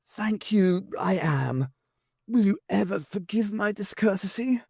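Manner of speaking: a lightly voiced frail old president; wheezy old voice. A narrator's reading. The old president greeting visitors from the darkness, lightly voiced. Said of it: "A wheezy old voice came from the darkness".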